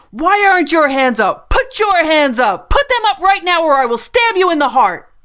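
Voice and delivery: loud, high, unhinged yelling; furious threats. A narrator's reading. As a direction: bark orders like a manic gunwoman. Gunwoman yells at Lizzie.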